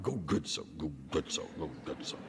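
fading low grumbly mutter; grumbling sound. Shown as a demonstration. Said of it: "It sounds like grumbling".

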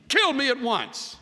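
high, loud, desperate cry; desperate plea. Acted. Moses begging God to kill him, loud and high. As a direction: beg for it to end. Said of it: "How's that for prayer?".

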